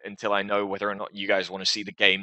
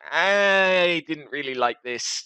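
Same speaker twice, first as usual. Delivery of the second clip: drawn-out, higher mock complaint; imagined disappointed viewer; 7 semitones higher, slower than usual. Host voices a viewer's negative comment, drawn-out mock reluctance. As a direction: stretch the first word high and reluctant. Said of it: "Leave a comment saying".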